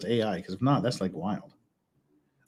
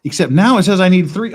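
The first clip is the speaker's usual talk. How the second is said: frustrated; 4 semitones higher, 9 dB louder than usual. Real, not acted. Frustrated at missing pieces.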